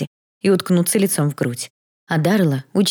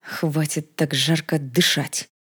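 slow, understated annoyed remark; irritated; much slower than usual. A narrator's reading. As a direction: grumble it slowly under restraint. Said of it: "раздражённо заметил принц".

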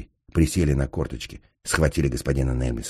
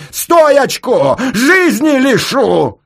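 very high, drawn-out, full-force yell; 12 dB louder, much slower than usual. A narrator's reading. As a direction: scream the threat with all your might. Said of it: "как заорёт". (истошный угрожающий ор)

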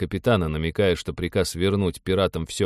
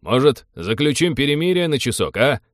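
raised, higher bargaining call; calling out an offer; 6 semitones higher, 4 dB louder than usual. A narrator's reading. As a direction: coax a hostile guard from a distance. Envoy calls out a truce offer.

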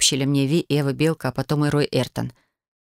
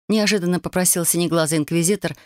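plain reading voice

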